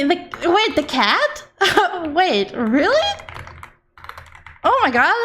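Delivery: real high-pitched, giddy disbelief; gasping and laughing in genuine shock; caught by surprise. Real, not acted. Streamer caught by an unexpected raid, astonished. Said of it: "you caught me by surprise".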